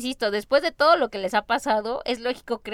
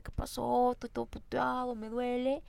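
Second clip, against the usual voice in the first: groggy, hurting; 10 dB quieter, slower than usual. Retold or imitated. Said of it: "despierta Peter".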